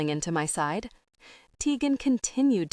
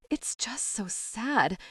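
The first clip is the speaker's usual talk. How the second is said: sobbed; 5 semitones higher, 4 dB quieter, slower than usual. A narrator's reading. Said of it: "she sobbed into the tissue".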